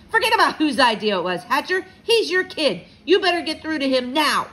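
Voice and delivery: high, loud impatient order; angry client. A narrator's reading. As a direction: snap an ultimatum at the father.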